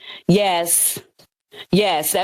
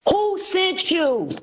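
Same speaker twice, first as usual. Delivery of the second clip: slow, high-pitched challenge; real heated interrogation, each word stretched; confrontational demand; 7 semitones higher, much slower than usual. Real, not acted. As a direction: draw it out as an accusing demand. Challenging an opponent in a quarrel.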